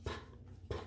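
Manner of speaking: "P" as a bare puff, twice; letter sound. Shown as a demonstration. Teacher showing the P sound, "P" as short "puh". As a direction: pop "P" as a quick consonant, separately. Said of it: "Make the P sound".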